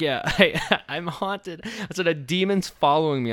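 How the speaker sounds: mock-terrified prank caller telling Dale a demon follows him; frightened believer act. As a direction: play a panicked believer, voice up and breaking into laughs. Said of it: "I put on, I was like".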